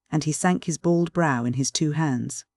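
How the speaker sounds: usual speaking voice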